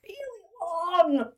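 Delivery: drawn out. Acted. Exaggerating pregnancy length.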